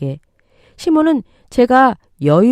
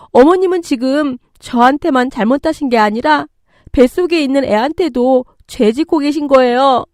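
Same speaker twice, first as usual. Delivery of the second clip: high, forceful accusation; outraged; 7 semitones higher, 4 dB louder than usual. Acted. Daughter-in-law defending her unborn child to mother-in-law, outraged. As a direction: reproach her with mounting indignation. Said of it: "참을 수 없는 분노가 치미는".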